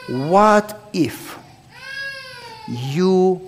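normal talking voice

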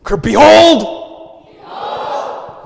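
very high, loud, slow shouted behold; top of your lungs. Shown as a demonstration. Pastor modelling a full-lung behold for the congregation, loud shout. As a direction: bellow like a restaurant ajusshi. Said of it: "the most Korean behold ever".

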